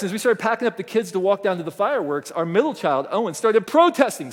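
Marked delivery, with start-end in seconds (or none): none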